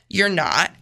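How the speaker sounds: ordinary talk, nothing marked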